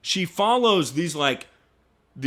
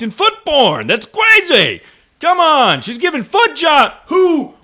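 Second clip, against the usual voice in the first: loud, high outburst of incredulity; exasperated disbelief; 11 semitones higher, 8 dB louder than usual. Real, not acted. Ranting about a crush's online porn, in loud disbelief. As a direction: shout it out, voice climbing in disbelief.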